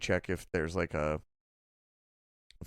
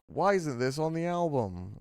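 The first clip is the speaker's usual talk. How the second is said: higher-pitched imitation of an online complainer; a hint of mock whining; lightly mocked commenter; 7 semitones higher than usual. Host imitates Reddit posters asking about the album, mock complaint. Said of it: "it's mostly posts of just, like".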